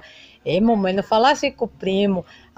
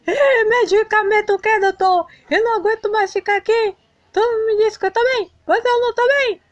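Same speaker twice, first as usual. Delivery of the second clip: high-pitched, fast whiny protest; fed-up kid voice railing at the doctor; angry outburst; 10 semitones higher, faster than usual. Acted. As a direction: Santiago refusing medicine, high whiny complaint. Sick boy rejects medicine.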